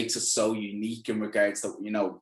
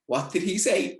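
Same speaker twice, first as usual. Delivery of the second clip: put-on puzzled young lads; 9 semitones higher than usual. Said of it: "they're like".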